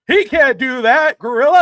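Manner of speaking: hammed-up manager yelling "He can't do that"; mock heel outrage. Heel manager protesting to Gorilla, loud and high. Heel commentator voiced in retelling.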